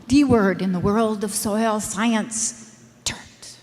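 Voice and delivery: hushed whisper, as if naming a taboo; stage whisper for dramatic effect; conspiratorial whisper. Shown as a demonstration. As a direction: lean in and whisper the forbidden word. Building up to the forbidden word.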